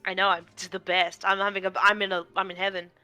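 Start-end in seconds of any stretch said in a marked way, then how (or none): none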